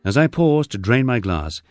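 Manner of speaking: usual speaking voice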